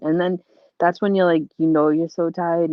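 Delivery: ordinary talk, nothing marked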